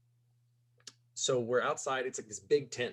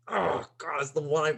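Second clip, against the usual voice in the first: excited gasp; 6 semitones higher, 7 dB louder than usual. Retold or imitated. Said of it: "and I was like".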